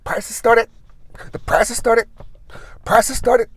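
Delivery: announcer on the store speakers; announcer teasing prices. Retold or imitated. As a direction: build suspense like an ad announcer. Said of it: "he goes".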